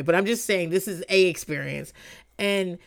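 ordinary talk, nothing marked

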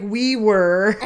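hammed-up confession; imitating woman. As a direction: blurt it proudly. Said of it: "She's like".

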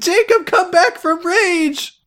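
very high squeaky plea. Pretend. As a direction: plead in a tiny high voice.

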